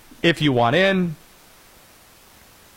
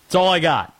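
ordinary talk

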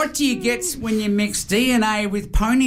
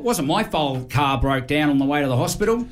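usual speaking voice

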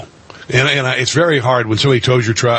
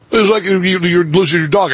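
normal talking voice

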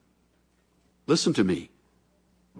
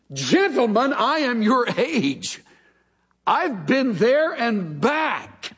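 indignant; 9 semitones higher, slower than usual. Acted. Job rebuking friends.

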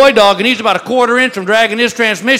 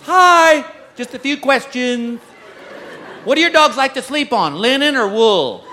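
put-on chirpy counter girl; 5 semitones higher than usual. Counter girl quizzing a dog owner, lightly chirpy. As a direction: lay on the customer-service sweetness. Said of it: "girl behind the counter goes".

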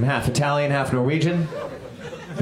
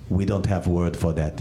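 put-on lower, deadpan Bulgarian accent; a hint of a flat Bulgarian accent, lower; 10 semitones lower than usual. Bulgarian man answering the comic, deadpan Bulgarian accent. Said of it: "he goes".

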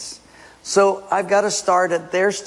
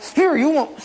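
angry Moses; 12 semitones higher than usual. Acted. Moses striking the rock.

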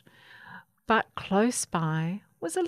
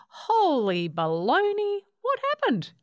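high-pitched astonishment; gasped in shock; 10 semitones higher, 4 dB louder than usual. A narrator's reading. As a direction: blurt it out, aghast at the sight. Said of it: "spotted Baby's little wing and gasped".

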